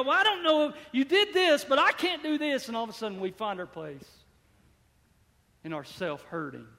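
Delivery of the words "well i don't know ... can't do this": doubtful believer's whine. Retold or imitated. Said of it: "we go".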